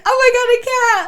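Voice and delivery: high, loud laughing shriek; on the couch, shrieking through laughter; shrieking panic. Retold or imitated. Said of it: "I literally shrieked".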